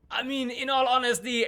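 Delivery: high-pitched character voice; the Duke. Acted. The Duke answering Phoebe's question, high-voiced and protesting. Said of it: "the Duke goes".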